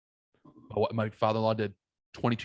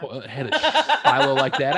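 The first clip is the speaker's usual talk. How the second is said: laughing; 14 semitones higher, 6 dB louder than usual. Real, not acted. Laughs while telling the story.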